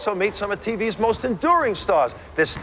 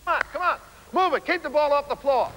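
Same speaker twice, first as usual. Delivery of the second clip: calling out; 7 semitones higher than usual. Real, not acted. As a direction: coach hollering at the players. Coaching players on court.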